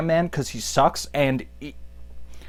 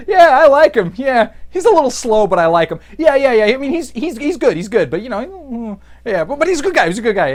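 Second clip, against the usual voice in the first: two-faced backhanded praise; 7 semitones higher, 4 dB louder than usual. Retold or imitated. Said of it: "talking shit behind his back".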